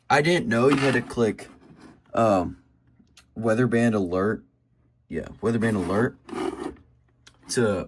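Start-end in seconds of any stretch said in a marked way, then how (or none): none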